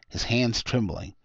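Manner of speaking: usual speaking voice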